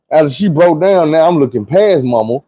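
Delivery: ordinary talk, nothing marked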